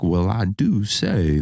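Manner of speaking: put-on slow, old-money Southern lady voice; mock genteel Southern accent, slow; moneyed Southern drawl. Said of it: "that kind of thing".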